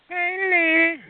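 very high sing-song call. Acted. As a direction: call her name way up high. Calling out to caller.

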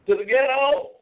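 high, incredulous repeat; mock disbelief. Real, not acted. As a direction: echo it back in scornful disbelief. Mocking disbelief at the rival show.

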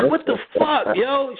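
high, squeaky disbelief; incredulous. Retold or imitated. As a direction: squeak out your outrage. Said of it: "I'm like".

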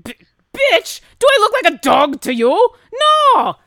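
loud, high outraged dwarf; furious gruff dwarf. Acted. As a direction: curse them out in a dwarf's roar. Plays angry dwarf.

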